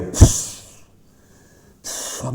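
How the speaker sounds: short puffed snort like a horse. Shown as a demonstration. Preacher shows the congregation how Jesus snorted, with a horse snort. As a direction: blow out a horse's snort, the second one voiceless. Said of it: "El sonido que hacen los caballos".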